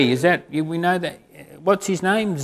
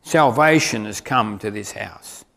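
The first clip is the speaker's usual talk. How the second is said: solemn; 6 semitones lower than usual. Acted. Jesus's declaration.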